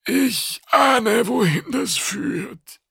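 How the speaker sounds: high creature voice, slowing at the end; wary alien official. A narrator's reading. Customs officer foreseeing the trap, high and wary. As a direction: say it high, then draw out the ending. Wary Kidang follows the argument.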